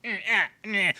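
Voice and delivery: high, far-off grunting voice; mock alien groove. Imitating the alien's jerky dance, high grunting. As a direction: hum it like a grooving creature. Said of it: "It's like".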